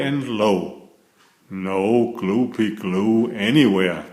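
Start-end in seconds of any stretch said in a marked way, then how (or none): none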